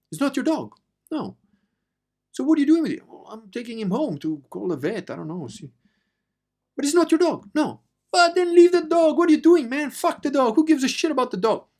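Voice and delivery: high, loud mock yelling of a big bloke; loud big guy. Big guy shouting at the narrator to leave the dog, loud. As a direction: bark it like a tough stranger who can't believe it. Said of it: "he goes".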